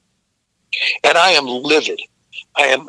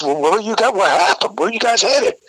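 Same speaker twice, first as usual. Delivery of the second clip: mildly startled, higher-pitched questions; retold surprise, pitched up; concerned questioning; 7 semitones higher than usual. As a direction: imitate a driver surprised by what he's picked up. Retold driver questioning stranded band.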